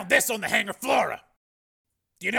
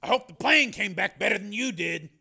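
grumpily; 7 semitones higher than usual. A narrator's reading. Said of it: "he said grumpily".

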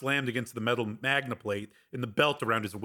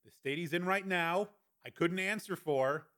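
higher, softer guard's voice; Evans, cautioning; 6 semitones higher, 5 dB quieter than usual. A narrator's reading. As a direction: excuse himself cautiously over the intercom. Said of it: "Evans' voice came through the speaker".

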